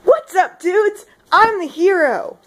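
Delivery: loud, high, brash American hero voice; hammed-up American dude accent; full American. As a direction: announce yourself with full American freedom power. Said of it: "Need to get American here".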